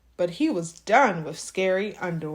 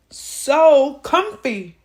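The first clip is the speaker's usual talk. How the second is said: cosy storyteller voice; 4 semitones higher, 8 dB louder, much slower than usual. A narrator's reading. Drawn-out contrast.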